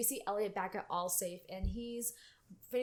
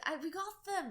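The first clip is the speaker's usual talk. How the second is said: put-on Irish accent; 9 semitones higher, faster than usual. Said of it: "I love his Irish".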